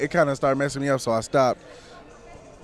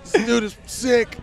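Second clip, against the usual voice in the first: put-on drunk voice; 9 semitones higher, slower than usual. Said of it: "he was just heckin' drunk".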